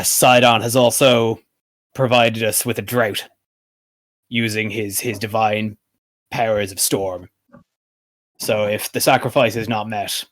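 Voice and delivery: grave, measured briefing with pauses; formal in-character appeal; troubled city ruler. Acted. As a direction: explain the threat as a weary ruler seeking help. Game master speaks as Pythor.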